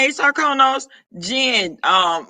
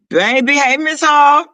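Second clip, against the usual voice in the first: normal talking voice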